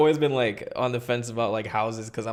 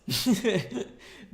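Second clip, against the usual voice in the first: high amused laugh; burst of laughter; 10 semitones higher than usual. Real, not acted. Laughs at creepy attic story.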